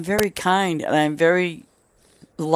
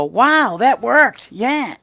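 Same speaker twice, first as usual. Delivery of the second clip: higher, brighter mock delight; pleased surprise; 6 semitones higher, 3 dB louder, much slower than usual. Person trying the trick, mock pleased surprise. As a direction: sound tickled that it worked. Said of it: "they go".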